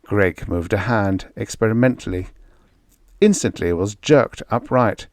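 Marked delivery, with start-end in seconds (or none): none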